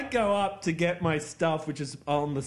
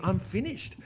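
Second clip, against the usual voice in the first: higher, pleased with himself; smug inner voice; 10 semitones higher than usual. Retold or imitated. Young hairdresser praising his own cut, a pleased inner voice.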